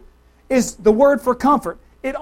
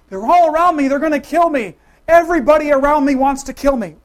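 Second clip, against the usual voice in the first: loud, higher paranoid outcry; panicked David; 4 semitones higher, 7 dB louder than usual. Acted. Preacher voices David hiding from King Saul, in mock panic. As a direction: cry out as if hunted. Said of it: "Why was he talking like that?".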